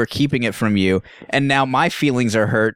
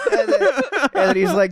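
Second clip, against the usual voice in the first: usual speaking voice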